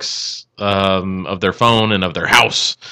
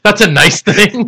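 high, loud, slow through laughter; helpless laughing disbelief; 14 semitones higher, 6 dB louder, slower than usual. Real, not acted. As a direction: crack up while saying it. Laughing at co-host's backhanded compliment.

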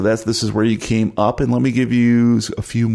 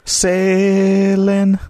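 high, drawn-out "sailing"; high dreamy echo; 11 semitones higher, 4 dB louder than usual. Pretend. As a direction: sing out the word dreamily.

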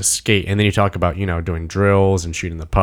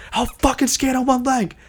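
high-pitched imitation of a defiant player. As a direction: act out a worked-up vow, voice way up. Retelling player's defiant outburst.

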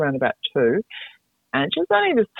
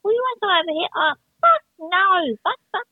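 put-on high tiny toddler swearing. Mother imitating her toddler daughter swearing in a tiny voice. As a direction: play a tiny girl cursing away. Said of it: "you'd hear her going".

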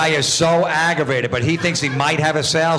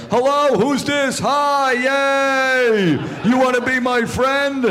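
high, slow, over-eager simpleton; goofy high-pitched telephone prankster; idiot character voice; 12 semitones higher, much slower than usual. Acted. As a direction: holler it like a hammed-up clueless innocent. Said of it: "This guy sounds like an idiot".

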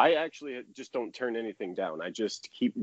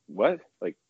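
higher-pitched retold disbelief; incredulous; 6 semitones higher than usual. Player retelling a surprise call from the Rangers, incredulous. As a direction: act out mild stunned suspicion.

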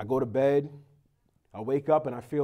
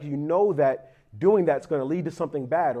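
ordinary talk, nothing marked

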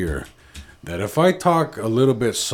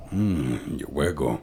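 deep, slow, lingering "Mm, yo hueco"; low seductive murmur; 11 semitones lower, 10 dB quieter, slower than usual. Shown as a demonstration. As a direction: purr it low like a pickup line. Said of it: "You gotta say it just like that".

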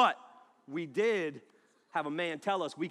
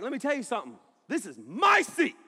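loud, higher mock-angry claim on the seat; angry Ryan; 7 semitones higher, 9 dB louder than usual. Friend Ryan refuses to give the security guard his seat, angry shout. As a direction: get in his face, high and loud. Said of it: "Angry Ryan".

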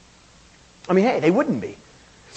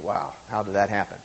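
quiet amazement; 11 semitones lower, 8 dB quieter than usual. Retold or imitated. Preacher's inner amazement.

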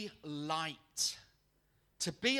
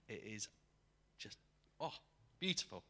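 ordinary delivery

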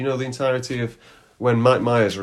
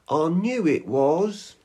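high, drawn-out claim; mock know-it-all; 5 semitones higher, much slower than usual. Character claiming to recognise Harry, slow know-it-all voice. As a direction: stretch it out as if never surprised.

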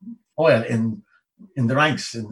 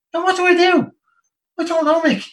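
squeaky pitched-up retelling; high mock voice; 5 dB louder than usual. Driver and soldier both lost, voiced high. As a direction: pitch it way up for the bafflement.

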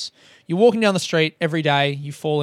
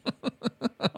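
soft, high mock chuckle round the word; Andy; 8 semitones higher, 7 dB quieter than usual. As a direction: imitate a serenely amused meditation guide. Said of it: "slightly amused by the thing".

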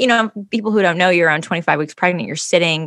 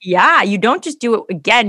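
ordinary talk

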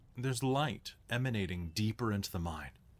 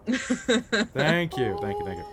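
hammy thanks; 11 semitones higher, 9 dB louder, slower than usual. Acted. Hammed-up gratitude.

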